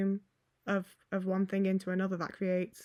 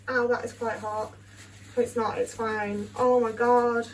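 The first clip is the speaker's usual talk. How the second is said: ordinary delivery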